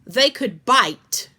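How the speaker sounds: loud, slow warning; said loudly. A narrator's reading. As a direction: warn them loudly and slowly. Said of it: "she said loudly".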